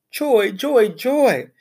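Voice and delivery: high, bouncy joy; joyful. Acted. Hamster rejoicing that the teacher likes him. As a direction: burst out happily.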